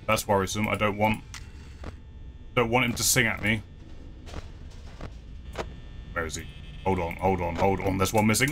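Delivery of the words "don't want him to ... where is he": ordinary delivery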